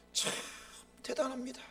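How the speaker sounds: normal talking voice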